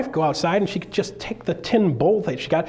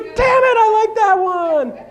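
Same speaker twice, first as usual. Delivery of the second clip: much higher, louder, slow laughing squeal; woman loving the line; delighted listener; 7 dB louder, much slower than usual. Retold or imitated. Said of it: "She's like".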